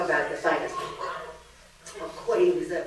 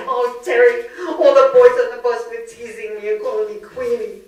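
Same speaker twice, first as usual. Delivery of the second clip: put-on higher, louder wailing girl's complaint; a wailing kid sister, pitched up and loud; upset twelve-year-old; 5 semitones higher, 8 dB louder than usual. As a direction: whine it like a hurt twelve-year-old to her big sister. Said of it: "And I said".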